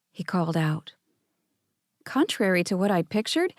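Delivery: usual speaking voice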